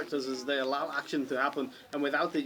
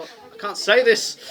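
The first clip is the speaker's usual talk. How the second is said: laughing; 6 semitones higher, 13 dB louder, slower than usual. Real, not acted. Breaks off laughing mid-answer.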